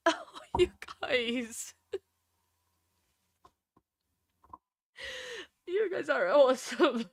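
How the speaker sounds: real tears breaking through the words; teary, laughing-crying gratitude; crying thanks. Real, not acted. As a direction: thank them while fighting off sobs. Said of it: "I cannot sob".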